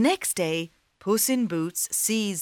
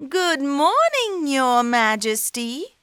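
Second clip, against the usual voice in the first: cat voice; 8 semitones higher, 4 dB louder than usual. Acted. Cat greets the king.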